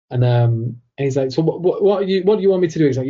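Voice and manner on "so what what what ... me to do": higher and louder, fast, fed up; a scholar throwing up his hands in disbelief; exasperated. Retold or imitated. Scholar retorting to a young lad's taunt, exasperated and fast.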